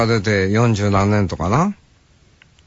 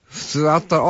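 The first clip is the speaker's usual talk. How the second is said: usual speaking voice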